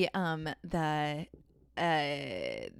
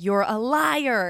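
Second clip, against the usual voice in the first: slow, loud mock yell; a drawn-out yell of "liar" at the scammer; all caps shout; 5 semitones higher, 8 dB louder, much slower than usual. Typing in all caps to the scammer, read as a slow mock yell. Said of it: "in all caps".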